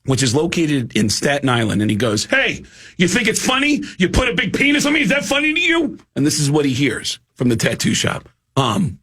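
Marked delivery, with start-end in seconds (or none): 2.2-6.0 high, indignant mock complaint; mock angry call. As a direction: confront the tattoo shop, hammed up. Drummer calling tattoo shop angry.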